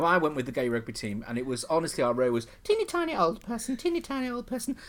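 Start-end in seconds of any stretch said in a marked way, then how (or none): 2.6-4.8 put-on squeaky teeny tiny. Mimicking tiny old people.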